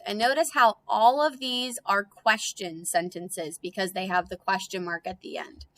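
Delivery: ordinary talk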